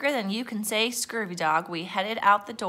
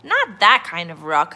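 loud, exasperated groan; 8 dB louder than usual. A narrator's reading. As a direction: groan it in protest. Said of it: "I groaned".

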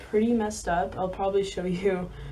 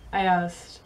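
ordinary delivery

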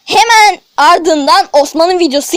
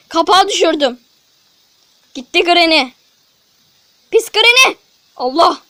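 ordinary talk, nothing marked

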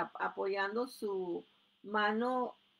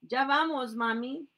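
calling back, louder and higher; 4 semitones higher, 7 dB louder than usual. Acted. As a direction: shout the answer to mom. Boys answer mom calling.

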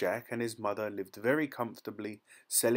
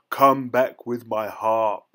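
loud, slow shouted command; giant's shout; 10 dB louder, slower than usual. A narrator's reading. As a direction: shout after the fleeing thief. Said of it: "shouted the giant".

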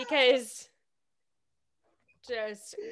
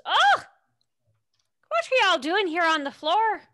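kindly woman; 6 semitones higher than usual. Acted. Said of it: "a kindly looking woman. And she goes".

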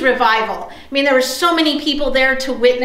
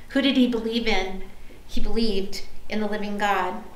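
normal talking voice

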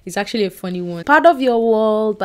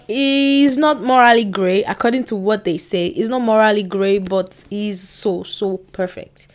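usual speaking voice

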